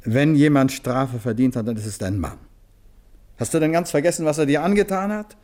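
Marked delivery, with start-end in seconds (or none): 3.4-5.3 rising higher, pitch climbing with outrage; indignant. Acted. Richard reminding Catherine what her husband did, indignant and higher. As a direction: let the indignation lift the voice.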